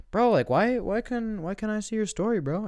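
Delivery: very high, whiny-plaintive bro voice; Martin. Acted. Excluded neighbor asks why he cannot see the story, plaintive.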